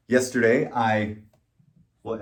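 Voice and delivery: usual speaking voice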